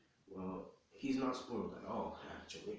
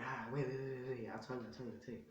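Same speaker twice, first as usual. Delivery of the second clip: panicked pleading; 4 semitones higher, 4 dB quieter, faster than usual. Acted. Son begs before being hit.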